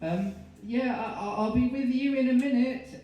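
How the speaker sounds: high, loud falsely casual call. Acted. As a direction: call through the door to stall him. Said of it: "Mary called".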